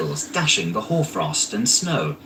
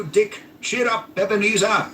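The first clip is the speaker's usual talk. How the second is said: put-on rally the boys merrily; jovial Fezziwig; 9 semitones higher than usual. A narrator's reading. Fezziwig calling his apprentices, jovial shout. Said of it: "cried old Fezziwig".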